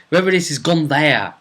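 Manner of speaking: ordinary talk, nothing marked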